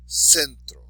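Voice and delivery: "centro" with CEN stressed; stress on next-to-last syllable. Shown as a demonstration. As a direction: lean on the first syllable of "centro". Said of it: "stress the underlined syllable".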